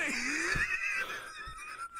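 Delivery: high, squealing long laugh; shrieking laughing fit; helpless laughter. Real, not acted. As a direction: burst into uncontrollable high laughter. Bursts out at the curtsy.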